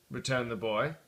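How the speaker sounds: ordinary delivery, nothing marked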